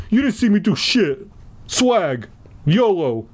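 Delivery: high-pitched, mouthy schoolboy voice; yelling Kevin. A narrator's reading. Student denies it to the teacher, high bratty yelling. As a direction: brag back in a high bratty voice. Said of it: "Kevin yelled".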